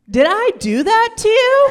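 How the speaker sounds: high, loud, thrilled question; proud excitement. Acted. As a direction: gush like a boyfriend amazed at himself. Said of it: "he was so proud of himself".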